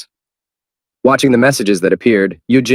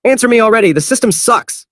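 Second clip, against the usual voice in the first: high-pitched yell at the system; high frustrated shout. Acted. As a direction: shout it out, fed up. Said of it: "Han Yu-jin began to shout".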